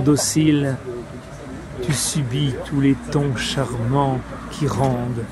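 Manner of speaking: solemn verse reading with long pauses between phrases; declaimed French poem, line endings falling away; poetry recitation. Acted. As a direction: recite it phrase by phrase, letting each image resonate. Recites Apollinaire's Le Brasier.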